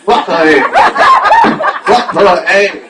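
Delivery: loud mock coaching shouts; hammed-up cheerleader. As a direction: bark encouragement from the sidelines. Acted cheering on from sidelines.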